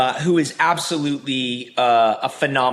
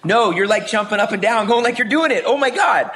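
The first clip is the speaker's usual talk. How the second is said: higher and louder, rising into a laugh; excited cheering; 8 semitones higher, 3 dB louder than usual. Retold or imitated. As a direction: cheer her on like she just nailed it. Uncle cheering niece riding bike.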